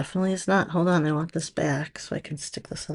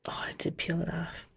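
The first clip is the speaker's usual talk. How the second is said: whisper; 11 dB quieter than usual. Real, not acted. Muttering to herself in surprise.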